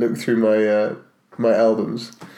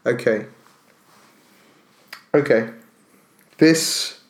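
ordinary delivery

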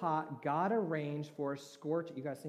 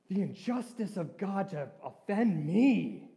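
indignant complaint; 6 semitones higher, 7 dB louder, slower than usual. Retold or imitated. Voicing Jonah's complaint.

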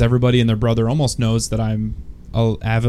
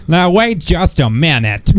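mock cowboy; 4 semitones higher, slower than usual. Joining the cowboy bit.

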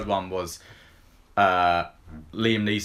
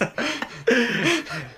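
high-pitched helpless laugh; laughing fit, pitched way up; real laugh; 3 dB louder than usual. Real, not acted. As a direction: crack up at your own attempt. Cracks up at own accent attempt.

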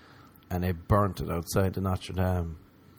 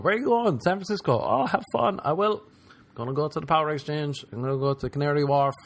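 chirpy tourist; 6 semitones higher than usual. Retold or imitated. Acted dialogue of tourist trip.

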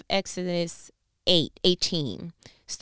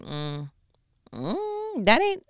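dismissive high voice; 6 semitones higher, much slower than usual. Pretend.

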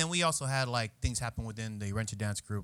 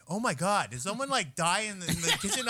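higher, laughing mock disgust; mock disgusted coworker; 7 semitones higher than usual. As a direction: play a coworker smelling kimchi. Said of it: "someone's like".

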